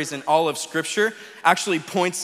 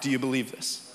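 lower, softer "Do you believe this" asked gently; quiet, earnest question; 6 semitones lower, 7 dB quieter than usual. Shown as a demonstration. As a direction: drop the voice and ask it softly. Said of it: "The most important question you'll ever answer".

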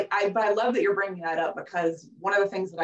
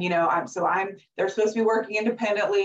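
normal talking voice

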